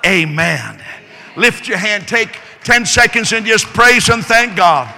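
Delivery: loud, high call to praise. Real, not acted. Preacher urging the congregation to praise, loud and high. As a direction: rouse the room to clap for Jesus.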